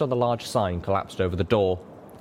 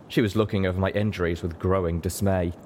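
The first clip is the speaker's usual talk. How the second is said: usual speaking voice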